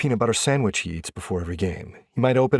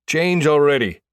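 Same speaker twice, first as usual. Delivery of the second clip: impatient call; 7 semitones higher, 7 dB louder than usual. A narrator's reading. Teammate hurrying the latecomer.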